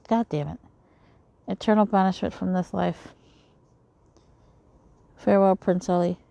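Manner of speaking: reading a dream sequence in character; lightly voiced sorcerer taunts; game dream scene read in character; voicing a villain scene.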